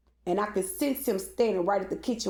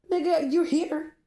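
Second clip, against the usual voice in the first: 1 confrontation; 8 semitones higher, slower than usual. Retold or imitated. Confronting man at truck.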